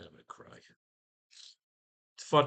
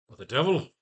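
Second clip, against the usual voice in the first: louder, higher startled exclamation; exclaimed; 5 semitones higher, 9 dB louder than usual. Shown as a demonstration. Writer tries Doctor's reaction line, loud and startled. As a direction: blurt it out in shock. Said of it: "Now he'd say".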